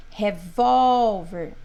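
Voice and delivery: Revólver, slow, with a loud drawn-out VÓL; stress on VÓL. Shown as a demonstration. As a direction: punch and stretch the middle syllable of Revólver. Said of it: "o ó". (Revólver devagar, com o ó bem forte e longo)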